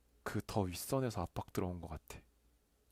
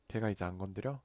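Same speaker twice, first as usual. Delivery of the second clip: ordinary delivery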